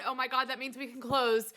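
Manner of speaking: normal talking voice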